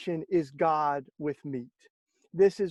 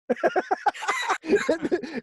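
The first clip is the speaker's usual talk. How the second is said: a loud high laugh that breaks out; high burst of laughter; 14 semitones higher, 4 dB louder than usual. Real, not acted. Telling the host about the bishop, a delighted high laugh.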